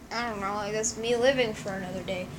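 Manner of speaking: ordinary talk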